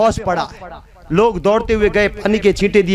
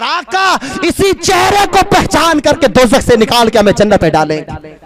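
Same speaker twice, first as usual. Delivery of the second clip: full-force preaching; 6 semitones higher, 5 dB louder than usual. Acted. Preacher promising rescue from hell, full-force preaching. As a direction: preach it out to the whole crowd.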